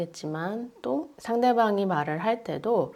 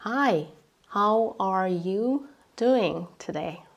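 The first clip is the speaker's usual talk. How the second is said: slow, word by word, keeping English stress; unhurried model sentence with the stresses kept; slow demonstration; much slower than usual. Shown as a demonstration. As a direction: stretch each word out for learners to follow. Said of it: "좀 천천히 해볼까요?".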